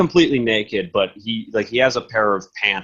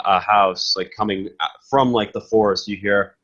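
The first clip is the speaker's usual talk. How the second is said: ordinary talk, nothing marked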